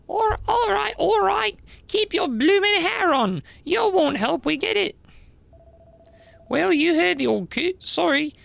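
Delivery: high-pitched, huffy character voice; exasperated slangy busybody. Acted. Busybody gives up on stubborn dragon, high and exasperated.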